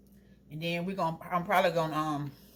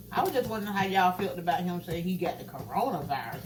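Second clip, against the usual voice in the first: usual speaking voice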